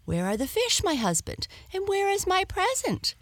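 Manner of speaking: high, quick, eager wife voice; rushing out, keen to see. Acted. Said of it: "His wife hurried to the cart and said".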